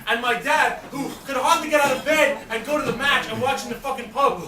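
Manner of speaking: ordinary delivery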